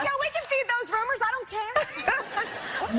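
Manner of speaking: high, giggly joking; laughing. Real, not acted. Joking with cast about dating rumors, high and laughing. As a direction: laugh while daring the rumors.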